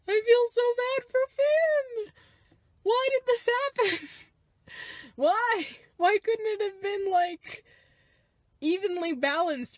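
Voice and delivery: high, loud, wailing complaint; whiny lament. Real, not acted. Lamenting Finn's treatment.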